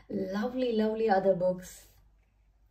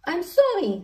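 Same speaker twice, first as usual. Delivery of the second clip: high, loud storybook apology; apologetic exclamation; 8 semitones higher, 6 dB louder than usual. A narrator's reading. Fire engine apologises after soaking Gordon, exclaiming. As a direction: blurt the apology out, raised pitch.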